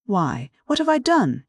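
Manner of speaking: a mild amazed stammer. A narrator's reading. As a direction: stumble over the words in disbelief. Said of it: "he stammered, amazed".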